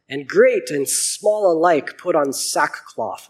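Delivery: steady Bible reading voice; clear, measured scripture reading. A narrator's reading. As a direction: read the passage out to the congregation. Reads Book of Jonah aloud.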